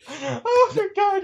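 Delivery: high, slow, laugh-cracked oh my god. Real, not acted. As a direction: wheeze it through helpless laughter. Laughing at own joke.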